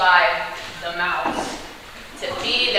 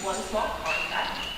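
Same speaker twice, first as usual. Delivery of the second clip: hushed coaxing; 9 dB quieter than usual. Acted. Coaxing the signature.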